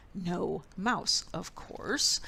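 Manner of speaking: usual speaking voice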